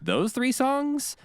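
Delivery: high, drawn-out disbelieving query; incredulous retold question. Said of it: "And they said, "Really?".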